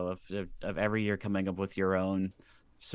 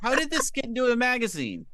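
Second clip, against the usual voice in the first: shocked; 13 semitones higher, 5 dB louder than usual. Retold or imitated. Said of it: "And I'm like".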